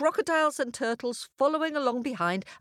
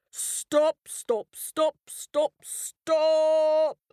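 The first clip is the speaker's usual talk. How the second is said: higher, insistent lion shouts; repeated roar; 8 semitones higher, 3 dB louder, much slower than usual. A narrator's reading. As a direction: hammer each stop to halt them. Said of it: "he roared".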